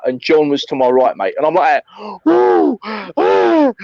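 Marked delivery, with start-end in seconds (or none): 2.2-3.7 mock out-of-breath panting, high woo sounds; imitating heavy puffing. Hoofing up the hill with the gun, mock breathless panting. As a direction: puff it out like a man dying on a steep hill. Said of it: "like, literally blowing".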